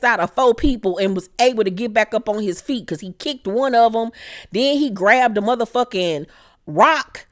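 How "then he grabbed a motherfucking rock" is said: usual speaking voice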